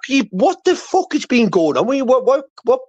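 high, incredulous what the fuck; baffled, voice shooting up high; shocked disbelief. Real, not acted. Host baffled at the argument.

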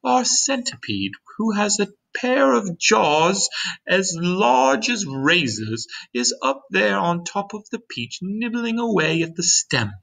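high-pitched, gentle lady's voice; kind ladybug voice. A narrator's reading. Ladybug standing beside James to explain, kind high voice. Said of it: "continued the ladybug".

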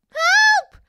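very high loud cry; shout for help. A narrator's reading. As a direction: scream for help. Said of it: "tried to shout".

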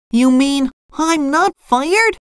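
high cartoon voice, timid; SpongeBob. A narrator's reading. Fry cook unsure he keeps his job, timid. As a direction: ask hopefully in a timid squeak. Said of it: "said SpongeBob timidly".

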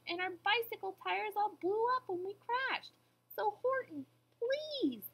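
high-pitched little mayor pleading. A narrator's reading. Mayor telling Horton of the crash, pleading. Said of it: "pleaded the voice of the mayor's".

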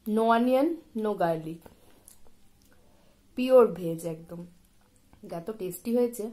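chewing food between words; speaking around a bite; mouth full while talking. Real, not acted. Seen 3: Holds momos throughout; bites at 5.9s, talks chewing after.

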